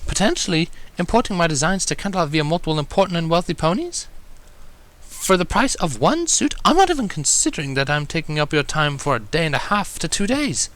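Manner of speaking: high refined lady voice; pleading with growing fervor; Rarity, earnest. Acted.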